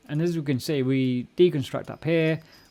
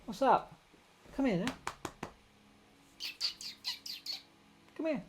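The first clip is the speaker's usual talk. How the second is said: pet talk; 12 semitones higher, 7 dB quieter than usual. Real, not acted. Calling the cat over.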